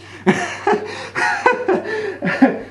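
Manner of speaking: long high cackle; derisive laughing. Acted. Director laughing at the actor who stormed out, high mocking laughter. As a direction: laugh helplessly at your own mockery.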